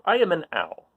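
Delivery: ordinary delivery, nothing marked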